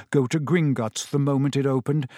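ordinary delivery

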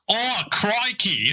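mock Australian, high and drawn out. Australian host mocking what everyone thinks Australians say, hammed-up Aussie. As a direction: ham up the Aussie cliché, slowly. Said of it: "us Australians just go around going".

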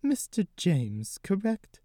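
drawn-out grandmotherly question; old lady. Acted. Gigi checks Carson's name, slow old lady voice.

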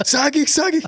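squeaky eager blurting; high-pitched and eager; high eager caller. Retold or imitated. Repeats meme voice.

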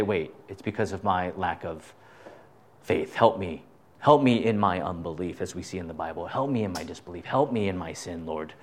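soft, low plea for help; hushed prayer. Acted. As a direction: pray it under your breath. Voicing a believer's prayer for help.

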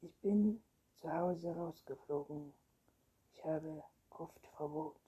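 usual speaking voice

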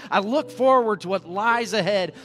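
loud, driving sermon exhortation; full-force preaching. Real, not acted. Preacher urging the church on in the race, forceful. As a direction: rouse the congregation to run the race.

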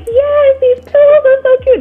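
high gushing delight; mock-gushing shopper; excited. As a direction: squeal it like you just found the perfect gift. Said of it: "and I was like".

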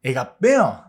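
imitated grandmother's Ibaraki twang; Ibaraki grandmother's dialect. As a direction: belt out an Ibaraki dialect phrase. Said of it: "お父さんのおばあちゃんなんか".

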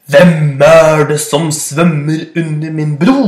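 slow loud booming roar; roaring goat. Acted. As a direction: roar it out like a big billy goat. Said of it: "brølte den største bukkene Bruse". (langsomt brølende bukk)

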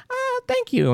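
very high, polite little voice; high mock-polite reply; imitated polite thanks. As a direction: imitate her high-pitched thank-you. Imitates girl's polite, awkward thanks.

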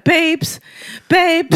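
imitating him with a high, pleading babes; squeaky, needy call for his partner; mocking high babes. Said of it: "Mike actually was like".

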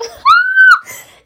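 loud, high burst of laughter; embarrassed laugh. Real, not acted. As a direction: shake off the emotion with a big laugh. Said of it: "I'm getting emotional".

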